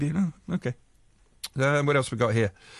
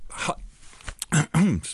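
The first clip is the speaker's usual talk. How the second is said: choking mid-word; 3 semitones higher than usual. Real, not acted. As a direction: voice catching in the throat. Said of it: "I'm choking on my own sentence".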